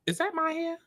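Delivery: high alarmed question; startled squeal. Real, not acted. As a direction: squeal at the hair. Finding hair in salad.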